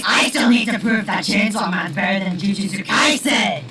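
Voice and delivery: put-on shrill, pushy girl shouting high; loud high character voice. Power character bursts in.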